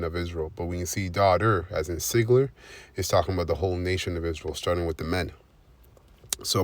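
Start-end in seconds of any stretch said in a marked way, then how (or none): none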